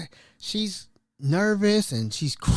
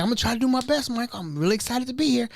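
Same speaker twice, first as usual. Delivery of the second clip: eager new cook; 6 semitones higher, faster than usual. Retold or imitated. New cook eager on first day.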